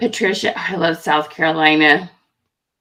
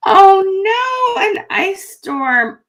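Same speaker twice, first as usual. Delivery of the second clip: higher, louder oh then slowing down; dismayed surprise; 11 semitones higher, 5 dB louder, much slower than usual. Real, not acted. Hears a viewer faces an ice storm, surprised. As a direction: gasp of sympathy.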